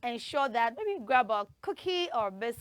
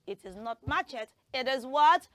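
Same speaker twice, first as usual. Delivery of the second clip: usual speaking voice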